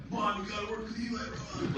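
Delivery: high-pitched mock kid voice; imitated celebrity's son. Son begging mom about a director, high mock voice. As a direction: plead in a put-on high voice. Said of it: "talks like that".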